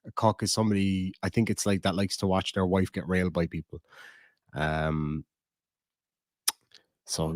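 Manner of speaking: ordinary delivery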